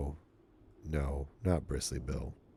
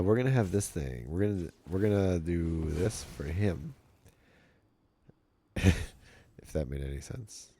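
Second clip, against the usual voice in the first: ordinary talk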